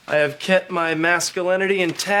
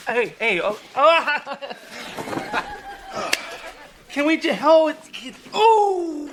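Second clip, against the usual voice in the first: pained groans; 11 semitones higher, slower than usual. Real, not acted. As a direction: cry out higher and higher. Massage hurts, deep tissue.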